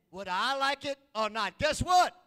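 loud, high shout on guess what; preaching burst. Acted. Preacher telling the church they are family, loud shout. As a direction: punch it out to the pews.

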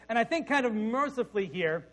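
normal talking voice

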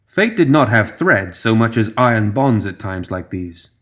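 ordinary delivery

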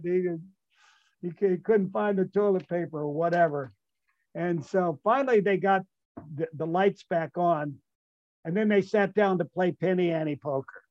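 normal talking voice

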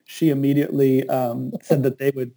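ordinary talk, nothing marked

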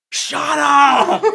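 villain's inner voice exploding at his boss; furious shout. Acted. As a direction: scream it as rage takes over. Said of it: "his internal monologue just goes".

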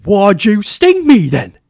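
high, loud, drawn-out mocking wail; bad line-reading impression. Co-host mimics the actor's line in the frog scene, over-the-top bad impression. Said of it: "His line reading during the scorpion".

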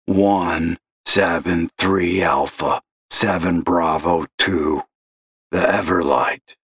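slow gravelly voice; a smoker's gravelly drawl on the phone; gravelly old caller. Acted. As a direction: drag it out like a heavy smoker. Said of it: "gravelly voice like that".

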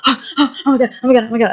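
mock hyperventilating alarm; imitated panic attack. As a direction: mimic someone starting to panic. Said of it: "she starts to have a panic attack".

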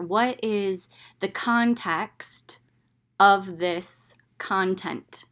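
slow, each word laid down; deliberate self-questioning. Retold or imitated. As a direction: spell out the question slowly. Asking herself the key question.

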